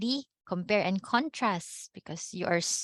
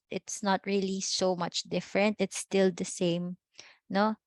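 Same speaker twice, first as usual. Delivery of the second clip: ordinary talk, nothing marked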